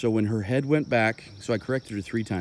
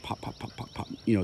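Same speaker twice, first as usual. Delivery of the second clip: quick whispered pops; soft mock clicking sounds; popping noises; 5 dB quieter than usual. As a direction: tap out the clicks under your breath. Mimicking rapid e-collar taps.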